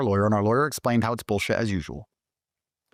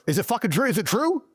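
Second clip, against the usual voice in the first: disbelieving excitement; 11 semitones higher than usual. Retold or imitated. Disbelieving call to his lawyer.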